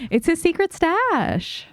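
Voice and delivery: high, drawn-out excited exclamation; delighted discovery. Real, not acted. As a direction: crow it high, stretching the words. Discovers a secret stash in game.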